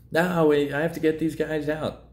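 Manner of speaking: high, desperate plea to save the men; a frantic sailor pleading over the intercom. Retold or imitated. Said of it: "the emotional guy on the other side".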